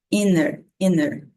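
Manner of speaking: INNER with no T after the N; N runs straight into the vowel in INNER; T dropped. Shown as a demonstration. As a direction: model "inner" for "inter", T left out. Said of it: "tirando ali o som do T".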